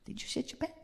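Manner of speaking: tiny high whispered squeak; audience's timid question. Acted. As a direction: ask it very softly and high, quickly. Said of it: "Y'all are like".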